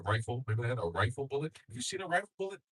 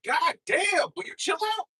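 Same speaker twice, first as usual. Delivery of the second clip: much higher, loud exasperated yell; mock Pope shouting; 9 dB louder than usual. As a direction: yell at him like a fed-up Pope. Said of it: "Even the Pope is like".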